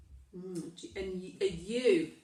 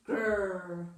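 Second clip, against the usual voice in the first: wolf growl; 3 semitones lower than usual. A narrator's reading. Wolf arrives in the story.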